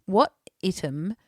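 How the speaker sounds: slow reading, "item" said "ITT-um"; "item" mispronounced like "ITT-um"; misread item. Retold or imitated. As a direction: read "item" wrongly with a short first vowel. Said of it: "she was reading out this thing".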